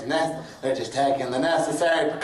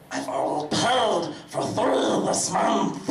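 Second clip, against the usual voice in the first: usual speaking voice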